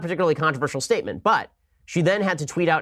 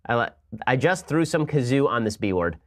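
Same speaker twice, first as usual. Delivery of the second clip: normal talking voice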